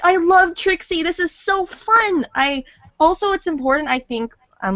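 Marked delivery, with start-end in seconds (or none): 0.0-2.3 high-pitched gushing delight; excited. Retold or imitated. Voice actor recalling how Trixie won her over, excited. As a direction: gush about it in a high, excited voice. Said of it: "before I get on the excited part".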